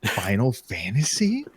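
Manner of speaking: slow, soft, awed question; half to yourself, slowly and softly; hushed wonder. Retold or imitated. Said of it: "I'm like".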